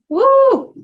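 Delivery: excited whoop. Real, not acted. Delighted by blueberry cobbler creamer.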